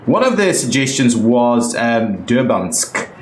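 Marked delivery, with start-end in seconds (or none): none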